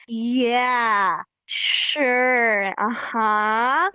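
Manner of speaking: drawn-out, sarcastic agreement; exaggerated sarcastic voice, dripping disbelief. Shown as a demonstration. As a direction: stretch each word with heavy sarcasm. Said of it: "I can make a sarcastic voice".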